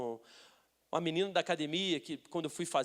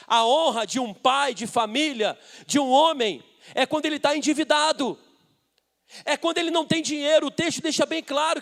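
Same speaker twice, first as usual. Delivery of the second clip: loud, insistent sermon delivery; fervent preaching; 5 semitones higher, 10 dB louder than usual. Real, not acted. Preacher telling of David's cave and indebted men, fervent preaching. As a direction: drive each phrase home to the congregation.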